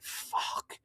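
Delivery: breathy whispered fuck; whispered curse. Retold or imitated. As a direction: mutter it under your breath in dread. Reacting to wife's finance request.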